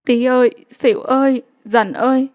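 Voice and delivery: mother's hoarse, grieving call. Acted. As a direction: call them through a worn-out throat. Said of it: "với giọng khản đặc".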